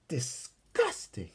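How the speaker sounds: slow, drawn-out disgust. Acted. Counselor Rocky watching the girls win, slow and disgusted. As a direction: spit the word out in disgust. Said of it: "said Rocky".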